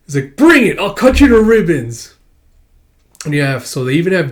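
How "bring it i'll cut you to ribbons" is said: high, loud, hammed-up threat; fierce comeback. The senior hamming up a threat at Akane, high and loud. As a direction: roar it back as a mock battle cry. Rival accepts challenge.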